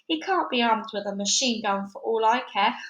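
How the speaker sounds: ordinary delivery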